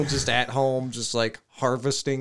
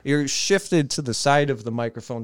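ordinary delivery

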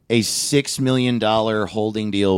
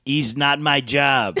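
slow, higher-pitched catchphrase in a put-on accent; Chico's accent; 4 semitones higher, slower than usual. Podcaster quoting Chico's catchphrase, mock accent. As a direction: imitate a sitcom catchphrase accent, drawn out. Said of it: "I'm sorry I'm doing that accent".

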